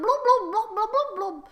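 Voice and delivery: burbling mock underwater language; imitated Aquan speech. Queg speaks Aquan to Elwindir, mock burbling. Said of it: "I'm gonna speak that instead".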